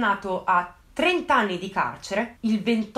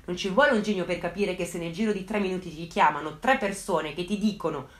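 ordinary delivery

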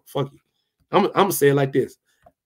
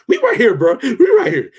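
excited laughing shout; 5 semitones higher, 4 dB louder than usual. Real, not acted. Shouting out his own channel.

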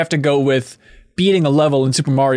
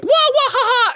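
Mario wah. Shown as a demonstration. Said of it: "But it's not wah".

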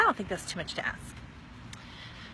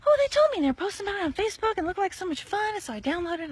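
high, louder, fast gushing friend voice; gushing girlfriend; 9 semitones higher, 4 dB louder, faster than usual. Retold or imitated. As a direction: play the friend bubbling with enthusiasm.

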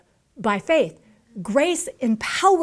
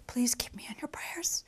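breathy whispered request; hushed mock plea; 14 dB quieter than usual. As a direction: whisper it like a needy aside.

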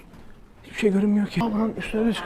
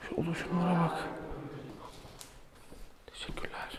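soft whispered thanks; 15 dB quieter than usual. Real, not acted. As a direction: barely breathe it. Whispering inside the cave. (fısıltı)

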